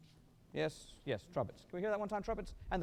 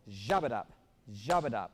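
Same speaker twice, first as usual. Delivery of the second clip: "jabba-dup" hit hard on "jab", rest bouncing off; strong first syllable; 5 semitones lower, 4 dB louder, slower than usual. Shown as a demonstration. Director showing the accent wanted, loud stress on "jab". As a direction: punch the "jab" of "jabba-dup" like a snare stroke. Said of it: "like a primary stroke on a snare drum".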